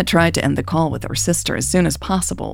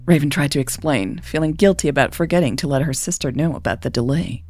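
ordinary delivery

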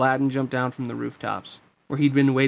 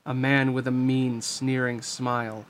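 usual speaking voice

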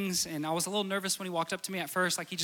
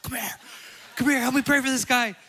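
calling out; 7 semitones higher, 7 dB louder than usual. Retold or imitated. Calling the pastor over.

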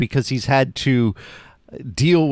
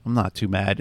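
gentle mother; 4 semitones lower, 5 dB quieter than usual. Retold or imitated. Host voicing the mother calming her son, low and tender. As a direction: lower and softer, tender reassurance. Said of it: "And she does".

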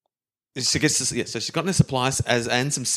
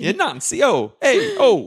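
high, climbing, laughing; laughing high squeak; 14 semitones higher, slower than usual. Acted. French owner losing it, laughing high.

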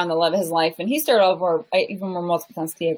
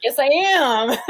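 higher-pitched mock indignant comeback; playful insistence; 6 semitones higher, 4 dB louder, slower than usual. Goofy adult answering teenagers, higher playful insistence. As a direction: assert it jokingly, pitched up.

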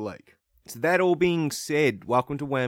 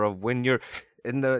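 normal talking voice

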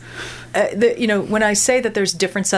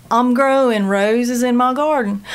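slow North Carolina drawl with a long O; North Carolina accent; 4 semitones higher, 3 dB louder than usual. Shown as a demonstration. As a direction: demo the coastal North Carolina O. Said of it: "that's the Elizabethan English".

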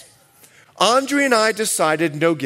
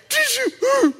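high shouted squeak of a question; mock squeal. Husband answering wife in labour, high squealed shout.

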